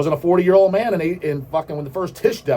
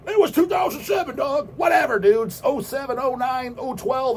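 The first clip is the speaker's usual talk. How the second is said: mock shout; 9 semitones higher than usual. Snapping back at a correction.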